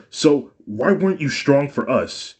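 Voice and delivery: high, loud accusing question; angry demand. A narrator's reading. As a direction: demand an answer from her. Son confronts mother.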